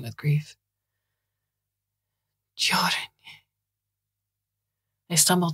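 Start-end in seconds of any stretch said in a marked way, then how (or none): 2.5-3.0 a faint whispered call. A narrator's reading. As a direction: breathe the name out softly. Mother calls name again, whispered.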